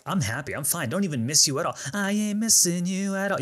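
high, sing-song insisting she's fine; chirpy fake unbothered; mock breezy denial. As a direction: overprotest lightly. Mocking own denial about ex.

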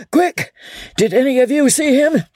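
high, urgent outburst broken by sneezy coughs; stammering between sneezes. A narrator's reading. Chief questioning his men between two sneezes, spluttering. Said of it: "managed to stammer between two sneezes".

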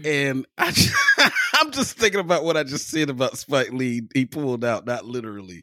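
laughing through the words; giggling while explaining the joke. Real, not acted. Laughing at his own joke.

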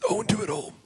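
whispered friend imitation; whispered impression. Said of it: "That's Malcolm Marius".

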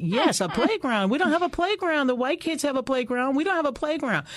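put-on a girl insisting to her mom she deserves it; rising childlike indignation; insistent child protest. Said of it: "I'm like".

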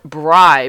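"brie" said as "bry"; ex-husband's mispronunciation. Retold or imitated. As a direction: say "brie" to rhyme with fry. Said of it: "because it's spelled B R I E".

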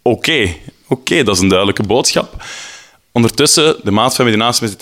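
ordinary talk

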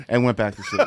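ordinary delivery

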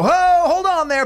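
hammed-up radio co-host pulling the brakes; mock radio host. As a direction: ham up the co-host reining him in. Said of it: "Stryker will go".

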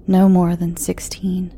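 ordinary delivery

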